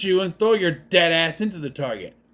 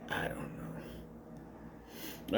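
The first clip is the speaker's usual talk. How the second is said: defeated whispered aside; giving up on the plot in a slow whisper; 19 dB quieter, much slower than usual. Real, not acted. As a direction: breathe it out, barely voiced, slow. Exasperated aside to viewers.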